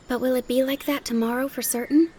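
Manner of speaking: put-on high little-girl voice, rising question; persistent child. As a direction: press for a sure answer. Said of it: "Heidi persisted".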